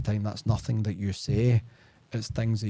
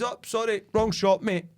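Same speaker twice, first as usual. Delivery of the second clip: mock apologetic brush-off; 8 semitones higher than usual. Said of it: "And I says".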